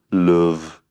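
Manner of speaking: "love" with a long rounded oo, "loov"; Liverpool love. Shown as a demonstration. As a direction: demonstrate "love" as they say it in Liverpool. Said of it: "That's the way they say it in England, in Liverpool".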